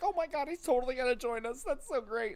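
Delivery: very high, excited gushing; mock freaking out. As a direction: imitate giddy fans freaking out. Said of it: "they were, like, freaking out".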